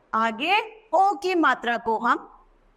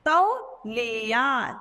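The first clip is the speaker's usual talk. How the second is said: तौलिया drawn out slowly, syllable by syllable; slow model word; much slower than usual. Shown as a demonstration. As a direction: stretch तौलिया for the pupils. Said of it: "तौ के साथ औ की मात्रा".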